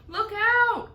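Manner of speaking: high, loud warning shout; urgent cry. A narrator's reading. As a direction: warn everyone of the hail. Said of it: "cried James".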